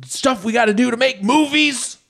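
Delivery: high shouty manic impression; Tom Cruise rant. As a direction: shout it like a manic movie star. Impersonating Tom Cruise ranting.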